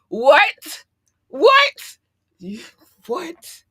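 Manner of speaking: high-pitched yelps of disbelief; astonished. Real, not acted. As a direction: shriek it, then run out of words. Amazed Tanzania is listening.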